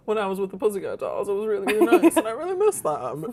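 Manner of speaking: high-pitched imitation of a wistful girl; hammed-up high wistfulness; mock sad. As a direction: mimic her getting sad, voice way up high. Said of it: "starts getting sad".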